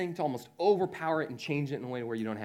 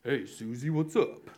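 mock macho, pushed a touch lower; trying to sound cool on the phone; boy greeting a girl in a lightly lowered macho voice; fake deep voice; slower than usual. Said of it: "my voice would go an octave lower".

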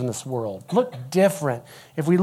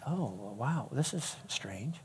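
hushed, slow retold wonder; very soft, drawn-out reaction; taken-aback mom; 5 semitones lower, 17 dB quieter, slower than usual. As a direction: murmur it as a bemused mom. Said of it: "the mom kinda looked at me like".

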